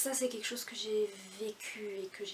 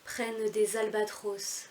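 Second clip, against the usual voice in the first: louder, measured verse reading with deliberate pauses; poem recitation; 7 dB louder than usual. Acted. Reciting Baudelaire's L'Albatros, as a measured poem recitation. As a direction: declaim the verse line by line, each phrase set apart.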